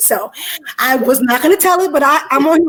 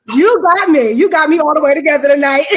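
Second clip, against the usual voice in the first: excited; 4 semitones higher, 6 dB louder than usual. Real, not acted. Moved after singing.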